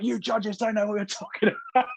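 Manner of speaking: high, exaggerated indignant jab at the judges; mock livid complaint; mock outrage. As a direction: play up the outrage, half-joking. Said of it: "I'm really not angry about it".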